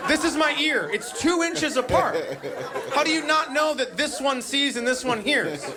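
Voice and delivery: put-on kid yelling. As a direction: yell it right into his eye. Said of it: "they yell into your eyeball".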